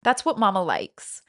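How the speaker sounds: ordinary talk, nothing marked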